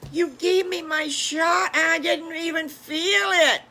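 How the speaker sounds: high, loud piglet voice, thrilled; excited piglet. Acted. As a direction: squeal it out like a delighted little pig. Piglet thrilled after his shot.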